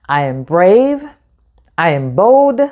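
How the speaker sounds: ordinary delivery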